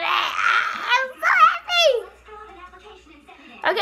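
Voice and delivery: high, half-laughing happy cry; teary squeal. Real, not acted. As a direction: squeal it through happy tears. Overjoyed about new camera.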